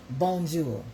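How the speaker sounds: mock French bonjour; French greeting. Shown as a demonstration. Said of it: "that's my French".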